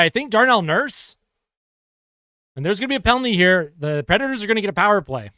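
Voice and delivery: brisk hockey play-by-play call; sportscaster calling the action. Acted. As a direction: call the game live as it happens. Live play-by-play of hockey game.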